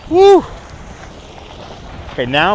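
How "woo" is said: excited cheer. Real, not acted. Cheering out on the walk.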